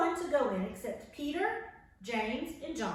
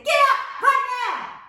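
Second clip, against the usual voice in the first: loud, high, impatient wake-up yell; mock mom shouting you out of bed; 11 semitones higher, 11 dB louder, slower than usual. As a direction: bark it like a fed-up mom. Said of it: "your mom may say".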